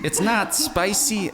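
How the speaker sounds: high, slow announcer correction. Pretend. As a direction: stretch out the retraction in a hammed-up ad voice. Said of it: "I'll do, like, an announcer voice for it".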